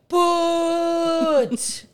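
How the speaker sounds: high, drawn-out call; retold farewell whoop; parting holler. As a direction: sing out the word long and high.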